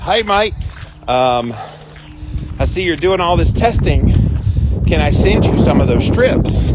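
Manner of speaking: put-on a friendly Australian doctor offering strips, in an Australian accent. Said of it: "I just imagined his accent".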